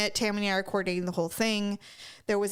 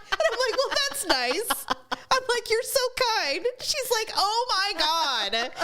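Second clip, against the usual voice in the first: much higher, laughing mock praise; laughing sarcasm; 13 semitones higher than usual. Mocking Tammy's kindness to the bereaved, laughing. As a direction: drip sarcasm through giggles.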